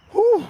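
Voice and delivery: big breathless whew after exertion; winded exhale. Real, not acted. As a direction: blow out air, heart pounding. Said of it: "Got the old man's heart rate up".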